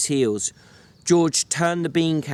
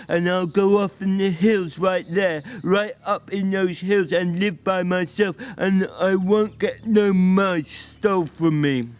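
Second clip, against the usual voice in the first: crafty Lenny; 6 semitones higher than usual. Acted. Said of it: "He'd sensed an advantage".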